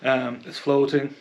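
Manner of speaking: ordinary delivery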